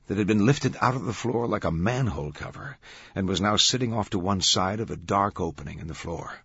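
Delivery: ordinary delivery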